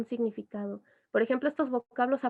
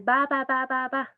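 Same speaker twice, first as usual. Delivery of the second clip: put-on baby babbling; 2 semitones higher than usual. Shown as a demonstration.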